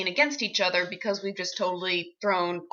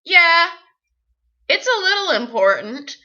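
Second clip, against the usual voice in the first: higher, louder retold comeback; defiant retort; 8 semitones higher, 7 dB louder than usual. Actor answering back to a scolding director, defiant. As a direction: answer back loud and high.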